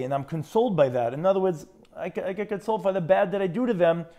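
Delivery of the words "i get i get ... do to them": normal talking voice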